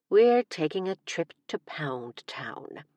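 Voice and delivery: slow suggestive whisper; a slow naughty whisper. Acted. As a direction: breathe the innuendo slowly. Teasing innuendo whisper.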